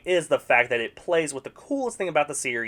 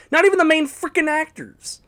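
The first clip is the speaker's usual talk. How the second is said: exasperated outburst; 14 semitones higher, 6 dB louder than usual. Acted. Exasperated rant on Mario film.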